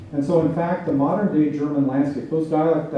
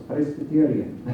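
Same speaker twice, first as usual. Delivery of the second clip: imitated Pennsylvania Dutch accent in English; Dutchy accent; slower than usual. Lecturer recalling a speaker's English, imitated Dutchy accent. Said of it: "hear a really Dutchy accent".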